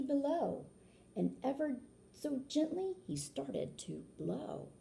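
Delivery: lilting read-aloud rhyme; storybook narration. A narrator's reading. Reading a rhyming book aloud to children, lilting. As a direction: read it like a picture book for kids.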